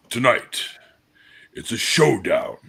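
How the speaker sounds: slow, portentous fight-night introduction; debate announcer. Pretend. Introducing a debate showdown, slow dramatic announcer voice. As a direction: space it out for dramatic build-up. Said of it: "I was kinda hoping I could do the".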